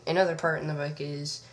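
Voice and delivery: usual speaking voice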